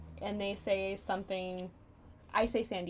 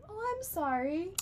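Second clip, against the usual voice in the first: 1 mock pity; 10 semitones higher, slower than usual. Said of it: "why are you saying it like they're from".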